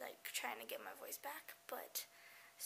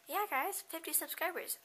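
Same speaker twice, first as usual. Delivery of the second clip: high, loud celebration of "fifty subscribers"; excited cheer; 6 semitones higher, 7 dB louder than usual. Real, not acted. Vlogger cheering her fifty subscribers, high and loud. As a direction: cheer it to the viewers.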